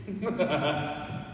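can't get the words out for laughing. Real, not acted. Preacher cracks himself up mid-sermon, laughing through the line.

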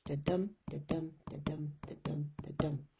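five even da-dums, weight on dum; iambic beat. Shown as a demonstration. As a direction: tap out da-dum steadily, easing off. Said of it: "We are stressing".

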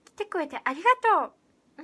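fast, loud, high bubbly thank-you; Rola impression. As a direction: rattle it off like a cheery idol. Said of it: "あ、似てないや".

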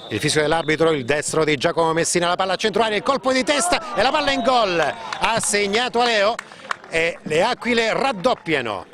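rising to a roaring goal call; breathless italian goal commentary. Real, not acted. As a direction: build fast and explode on the goal. Goal scored, commentator erupts. (telecronaca del gol senza fiato)

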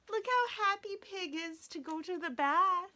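usual speaking voice

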